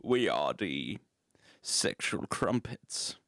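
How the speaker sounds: very low, slow rock-star voice, fading to a whisper; deep, slow, breathy rock-singer impression. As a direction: drop way down and drawl it like a grand rock frontman. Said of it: "I'm trying to do [name] from Whitesnake".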